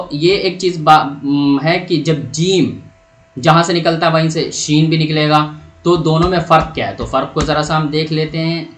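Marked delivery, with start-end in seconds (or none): none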